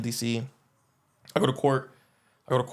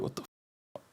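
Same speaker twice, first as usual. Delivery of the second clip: whisper; 11 dB quieter, much slower than usual. Retold or imitated. New inmate eyeing the homies.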